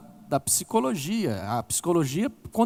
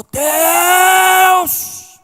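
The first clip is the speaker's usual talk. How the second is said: long, high, loud anguished cry; cry to God; 11 dB louder than usual. Retold or imitated. Grieving woman crying out to God in class, long loud wail. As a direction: let out one long wail of "Deus". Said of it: "Essa mulher deu um grito naquela classe".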